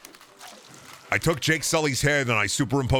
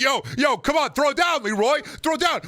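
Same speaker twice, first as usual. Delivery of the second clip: mock street tough; 13 semitones higher than usual. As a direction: jeer like a schoolyard bully.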